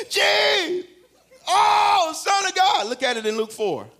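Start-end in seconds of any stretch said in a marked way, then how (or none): 0.1-2.9 high, loud demon scream; screaming spirits. Acted. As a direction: scream like a spirit being cast out. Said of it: "the spirits were screaming".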